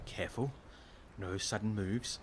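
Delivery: very soft, cautious warning; hushed warning. Acted. Warning beside a dangerous creature.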